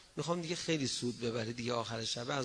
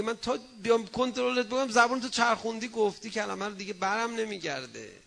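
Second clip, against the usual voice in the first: higher and louder, an angel throwing up its hands; exasperated angel; 8 semitones higher, 7 dB louder than usual. Acted. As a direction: voice the helpless angel with a loud raised plea. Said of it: "میگه".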